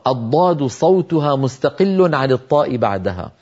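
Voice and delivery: usual speaking voice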